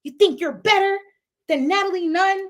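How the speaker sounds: a girl angrily ordering her out, mock yelling; mock angry. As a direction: throw her out with mock fury. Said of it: "she was like".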